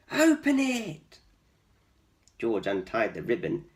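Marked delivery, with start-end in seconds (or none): 0.1-1.2 animals urging. Acted. Animals urge George.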